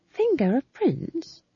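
high, wary query; uneasily. A narrator's reading. Young witch puzzled by the tale, uneasy. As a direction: ask it uneasily. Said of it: "said Magrat uneasily".

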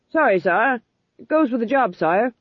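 apologetic sergeant. A narrator's reading. Sergeant excusing his shout to the king, soldierly. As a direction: answer like a soldier on duty. Sergeant answering the king's reproof.

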